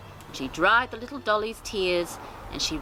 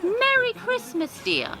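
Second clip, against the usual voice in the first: put-on high, slow sweet old lady; grandmother voice. Grandmother wishes the robin merry Christmas, slow and high. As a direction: draw it out kindly like a granny.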